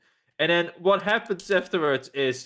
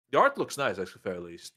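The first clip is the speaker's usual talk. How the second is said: usual speaking voice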